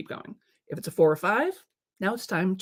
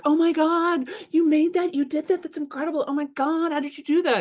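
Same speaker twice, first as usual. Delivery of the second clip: high, gushing fan voice; gushing praise; 9 semitones higher than usual. Retold or imitated. Friends gushing over your work, high and excited. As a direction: rave about their work. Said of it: "everybody else is like".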